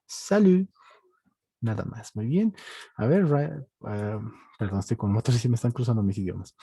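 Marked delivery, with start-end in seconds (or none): 0.0-0.7 salut raised in pitch, ending on the vowel; silent final T. Shown as a demonstration. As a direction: model salut high, with no T. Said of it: "Las T's al final se vuelven muda". (salut agudo con la T final muda)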